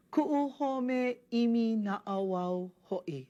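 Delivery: slow, careful Hawaiian line for students to copy; slow model reading. Shown as a demonstration. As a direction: read each Hawaiian word slowly and clearly. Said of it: "reads line for students to mimic".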